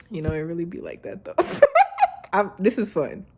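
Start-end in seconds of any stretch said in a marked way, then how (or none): 1.4-2.3 laugh. Real, not acted.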